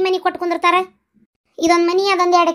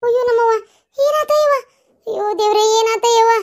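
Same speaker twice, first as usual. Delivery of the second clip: worried wail; 5 semitones higher than usual. Acted. Wailing over the fainted girl.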